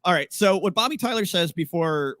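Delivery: normal talking voice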